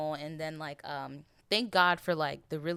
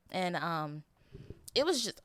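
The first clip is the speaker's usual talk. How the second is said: normal talking voice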